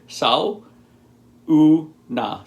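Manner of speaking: "sau, u, na" said slowly in three split syllables. Shown as a demonstration. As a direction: break "sau, u, na" into three separate beats. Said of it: "So three syllables".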